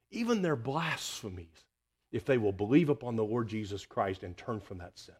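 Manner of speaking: ordinary talk, nothing marked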